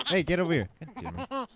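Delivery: loud high exasperation; exasperated yell. Real, not acted. Chasing escaped baby again.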